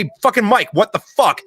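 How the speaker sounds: imitating a furious senior NCO bursting in; angry yell. Master sergeant confronting the first sergeant, angry yell.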